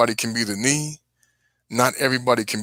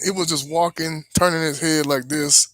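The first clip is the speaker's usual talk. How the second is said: normal talking voice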